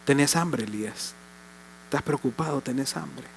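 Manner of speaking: tender. Acted. God comforting hungry Elías.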